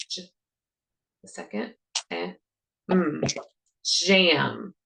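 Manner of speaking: each sound of "jam" said alone, then blended; segmenting sounds. Shown as a demonstration. Teacher models segmenting "jam" for students, sound by sound. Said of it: "I am going to pick up the first sound".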